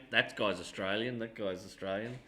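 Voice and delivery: a soft, hammed-up Kiwi accent; mock Kiwi accent, dropping off; bad Kiwi accent. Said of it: "That's a really bad Kiwi accent".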